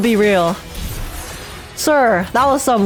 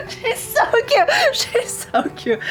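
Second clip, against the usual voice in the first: high squeal through laughter; gushing squeal; 12 semitones higher, 4 dB quieter, slower than usual. Real, not acted. Streamer overwhelmed by a cute character, gushing squeal. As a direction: squeal helplessly, half laughing.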